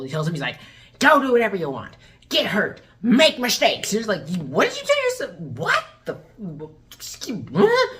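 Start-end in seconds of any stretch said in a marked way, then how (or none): 1.0-3.9 louder, hammed-up imitation of a father; mock fatherly urging. Dad sending son off, hammed-up loud urging. Retelling dad's reckless send-off. 4.4-7.9 high squawks of disbelief; mock bafflement. Baffled reaction to dad's bad advice, hammed-up disbelief.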